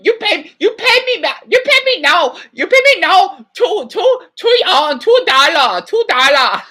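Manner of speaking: hammed-up imitation of a Chinese vendor; mock Chinese accent. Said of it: "I can't really do a Mandarin".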